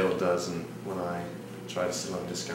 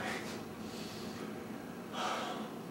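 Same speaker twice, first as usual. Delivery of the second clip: long, quieter sigh; spent, fed-up exhale; exasperated sigh; 10 dB quieter than usual. Acted. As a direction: breathe out long after ranting. Ends rant about making subs.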